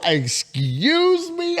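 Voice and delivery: very high, slow excuse me; drawn-out disbelief. Retold or imitated. Hearing the neighbor's liquor plan, slow high disbelief. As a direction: stretch it in mock shock.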